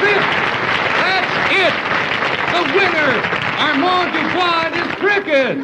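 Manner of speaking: excited announcement. Acted. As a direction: announce the winner like a game show host. Host announces winner, ecstatic.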